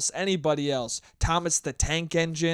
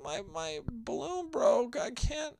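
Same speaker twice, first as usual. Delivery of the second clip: put-on high, soft, slow tearful kid voice; whimpering kid; 7 semitones higher, 7 dB quieter, slower than usual. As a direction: play a kid on the verge of tears. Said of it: "he's like".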